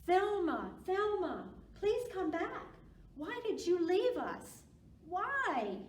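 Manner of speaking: hammed-up storybook wail; fans wailing. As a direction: beg her to come back like wailing fans. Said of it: "her fans did wail and cry".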